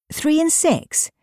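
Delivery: high, drawn-out insistent question; incredulous high-pitched query, not loud. A narrator's reading. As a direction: insist on it slowly, pitched well up. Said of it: "insisted her husband loudly".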